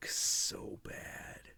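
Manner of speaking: hushed pained whisper. Real, not acted. Pitying the young actor, whispering. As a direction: breathe it out in dismay. Wincing aside about performance.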